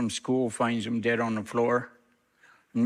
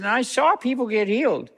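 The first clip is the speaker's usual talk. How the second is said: higher, heated retold accusation; animated; 11 semitones higher, 4 dB louder than usual. Matt confronting the pastor across the table, heated. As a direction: push it up and out. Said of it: "all of a sudden got animated".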